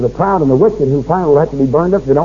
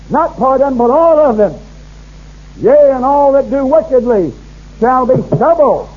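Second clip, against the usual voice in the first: preaching scripture; 5 semitones higher than usual. Acted. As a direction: thunder out the prophecy like a preacher. Reads the Malachi verses out preaching.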